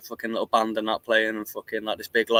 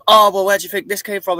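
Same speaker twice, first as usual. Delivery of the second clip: mocking gossiper; 10 semitones higher, 6 dB louder than usual.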